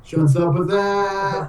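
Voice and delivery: high, drawn-out goofy greeting; playful greeting. Acted. Player as Chunt greeting a friend, playful drawl.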